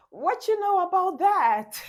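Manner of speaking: high, loud teasing laugh; a woman teasing her man through laughter; laughing tease. Acted. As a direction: toss it out mid-laugh, mocking him playfully. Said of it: "both of them are just laughing".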